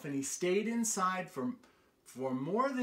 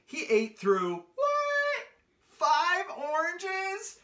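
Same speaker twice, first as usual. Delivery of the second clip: mock astonishment; 12 semitones higher, 6 dB louder, slower than usual. A narrator's reading. Reading to children, hammed-up shocked squeal. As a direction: squeal a high, loud, slow what, five oranges. Storyteller feigns astonishment at oranges.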